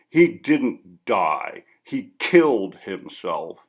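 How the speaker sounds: each word set down slowly and hard; very slow, heavy correction of the interviewer; slow bitter correction. Acted. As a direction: spell it out slowly, cutting him off.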